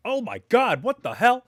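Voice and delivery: loud, high-pitched exclamation of disbelief; mock shock. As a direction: blurt it out, hammed-up and startled. Acts out shocked reaction at drive-thru.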